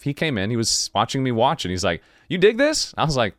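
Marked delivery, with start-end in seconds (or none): 2.3-2.9 curious guy. Retold or imitated. Guy at studio asks him.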